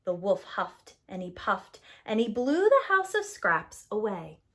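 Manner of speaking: plain reading voice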